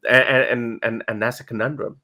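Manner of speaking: ordinary talk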